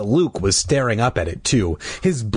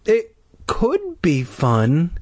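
slow, higher, tentative voice; hesitant boy; 7 semitones higher, much slower than usual. A narrator's reading. Timid brother half agreeing, hesitant. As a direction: offer it timidly, drawn out. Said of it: "Clay said quietly".

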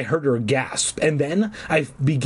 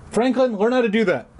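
high playful aside; 9 semitones higher, faster than usual. Real, not acted. Joking aside to his dog.

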